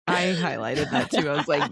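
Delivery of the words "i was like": normal talking voice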